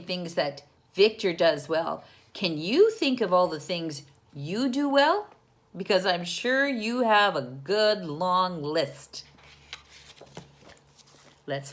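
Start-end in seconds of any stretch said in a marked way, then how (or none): none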